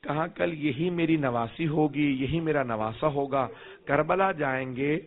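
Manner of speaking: low, soft grieving recitation; hushed lament of the sermon's close; mournful lament. Acted. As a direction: let the voice sink into quiet mourning.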